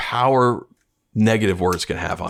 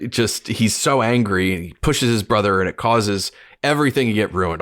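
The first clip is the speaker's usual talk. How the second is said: ordinary delivery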